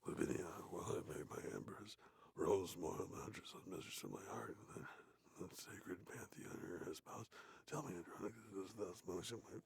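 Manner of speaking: reading the play in a whisper. Real, not acted. Reading Titus to listeners with a sore throat, whispering. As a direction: hush it, read it whispered. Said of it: "I like talking quieter".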